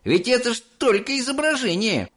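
put-on high, smirking kid's voice; a boy scoffing at the idea. A narrator's reading. Mike scoffing at Mr Wonka's order. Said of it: "усмехаясь".

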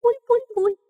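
high squeaky gurgling underwater; drowning gurgle. Acted. Chick sinking in water.

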